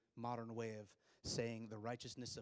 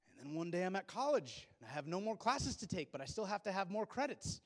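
ordinary talk, nothing marked

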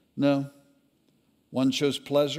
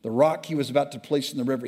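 ordinary delivery

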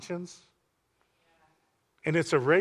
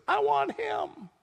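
much higher, slow squeaky mock voice; mock eager crowd; slower than usual. Courtroom crowd choosing Joe Pesci, comic high squeal. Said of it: "And everybody says".

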